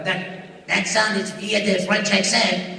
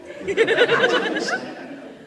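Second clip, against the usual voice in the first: high cackle. Acted. Character laugh.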